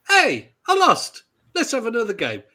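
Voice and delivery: high, bright-spirited call to play on; upbeat good loser. Acted. Telling little children how to lose, upbeat good loser. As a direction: toss it off high, like losing is no bother. Models good losing for young players.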